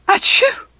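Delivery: a sudden, loud, high acted sneeze. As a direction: burst out with a big sneeze. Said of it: "I sneeze".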